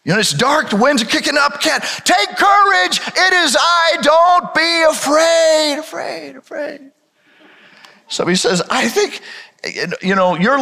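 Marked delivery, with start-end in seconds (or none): 2.0-5.8 Jesus shouts to the disciples over the storm; shouting over the wind. Acted. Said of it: "Jesus says". 5.8-6.9 much softer echoing repeat; fading echo. Acted. Echo after shouted line.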